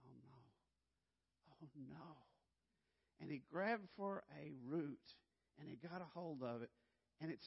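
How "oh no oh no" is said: soft dismayed oh no; hushed dread. Retold or imitated. Father watching his son slip, soft horrified oh no. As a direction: breathe it out in quiet horror.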